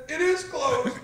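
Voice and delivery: high, loud imitated comeback; insistent retold protest. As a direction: protest it back, imitating the injured boy. Retold injured boy answers back.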